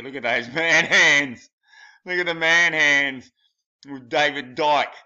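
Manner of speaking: loud jeering at the man hands; mocking shout. Real, not acted. Vlogger jeering loudly at a celebrity photo's hands.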